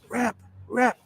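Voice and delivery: high-pitched mock croak of a sick caller; imitated sick voice. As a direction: imitate a caller whose voice is failing. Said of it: "what's the matter with your voice?".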